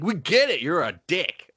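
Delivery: high-pitched mock annoyance; fed-up onlooker, played for laughs; acted-out exasperation. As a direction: heckle back, pitch jumping up.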